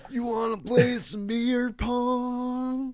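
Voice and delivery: high, slow, drawn-out party bro; frat bro. Acted. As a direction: whine it like a goofy bro. Voicing zombie frat boy.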